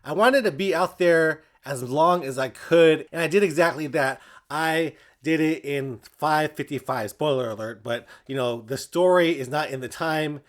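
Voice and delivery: ordinary talk, nothing marked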